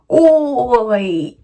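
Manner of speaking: loud, drawn-out mock British; UK accent attempt. Shown as a demonstration. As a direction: belt out a hammed-up UK accent. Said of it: "Do my best U K accent".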